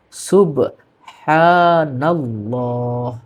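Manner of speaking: সুবহানাল্লাহ drawn out very slowly, ending on a clear h; syllable by syllable সুবহানাল্লাহ; correct pronunciation. Shown as a demonstration. Teacher repeating the correct form very slowly. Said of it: "সঠিক উচ্চারণ".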